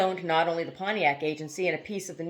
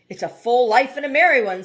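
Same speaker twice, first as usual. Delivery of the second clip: loud, higher, wry exclamation; wry old man; 6 semitones higher, 9 dB louder than usual. A narrator's reading. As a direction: toss it off with bitter cheer. Trout's wry remark.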